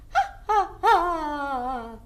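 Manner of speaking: high, loud cackling witch laugh; witch cackle. Shown as a demonstration. Teacher shows the class a witch's cackle.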